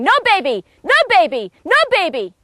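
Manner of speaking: frantic repeated no, mildly acted; hysterical woman. Caller's mother-in-law shouting down the phone, hysterical. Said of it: "hysterical woman's voice saying".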